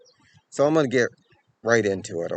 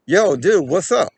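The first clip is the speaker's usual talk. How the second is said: higher, louder call to a stranger; 6 semitones higher, 7 dB louder than usual. Retold or imitated. Confronting a man stuffing a girl into a car, loud call. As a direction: hail him sharply from a few steps off. Calling out to abductor.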